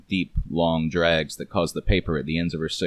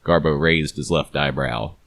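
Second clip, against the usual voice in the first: usual speaking voice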